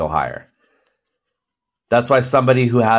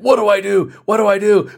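hoog en gejaagd, gespeelde paniek; panicking body; 9 semitones higher than usual. Pretend. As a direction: laat het lichaam overdreven in paniek roepen. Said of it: "it's like".

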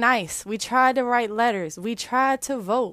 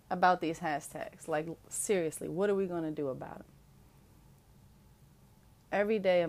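weary hushed lament; 6 semitones lower, 8 dB quieter than usual. Real, not acted. A grieving woman tells viewers she is tired, softly and wearily. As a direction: let every word sag with tiredness.

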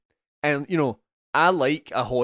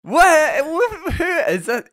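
high, loud incredulous what; half-laughing disbelief, pitched way up; baffled disbelief; 7 dB louder, much slower than usual. Retold or imitated. Retelling his own confusion at the film to a co-host, incredulous.

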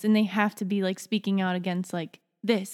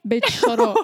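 babyish cartoon voice; 5 dB louder than usual. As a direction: say it like a little kid. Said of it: "Why did I actually sound like it?".

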